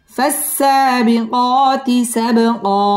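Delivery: chanted slowly, long vowels stretched; slow Quranic recitation. Shown as a demonstration. Teacher recites the verse twice for students in slow chant. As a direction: draw out every long alif.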